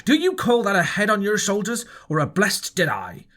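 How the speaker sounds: angry cry. A narrator's reading. Long John mocking Morgan's answer, crying out angrily. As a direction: jeer at the sailor's thick head. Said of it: "cried Long John".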